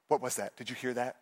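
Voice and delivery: hushed scared question. Retold or imitated. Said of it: "She goes".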